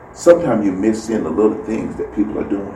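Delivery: ordinary talk, nothing marked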